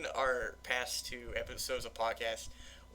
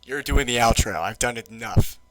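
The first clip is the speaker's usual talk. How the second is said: much louder, insistent; loud insistence; 15 dB louder than usual. Real, not acted. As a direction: insist loudly, you do it. Pushing the outro onto Red.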